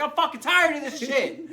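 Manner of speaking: loud high shout, half laughing; fed-up yell. Retold or imitated. Snapping back at the staring crowd, loud laughing shout. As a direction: yell it out, done with everything.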